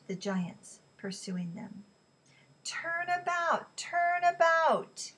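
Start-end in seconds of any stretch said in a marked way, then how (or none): 2.6-5.1 high, long drawn-out call; slow, sustained official command; bullhorn order. A narrator's reading. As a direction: stretch each word as if hailing a ship. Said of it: "the bullhorn ordered".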